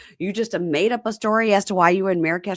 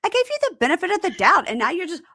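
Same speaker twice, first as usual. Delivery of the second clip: high, loud hammed-up outrage; mock outraged rant; 9 semitones higher, 6 dB louder than usual. Imitating the girlfriend ranting at her boyfriend, high and loud. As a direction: rant at him in a shrill mock fury.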